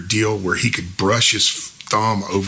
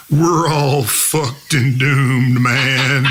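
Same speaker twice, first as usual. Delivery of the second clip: slow, higher mock-panicked movie marine; 5 semitones higher, 4 dB louder, much slower than usual. [name] clowning for the band, a poor movie-character impression. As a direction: drag it out like a failing Hollywood impression. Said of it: "he does this impression of [name]".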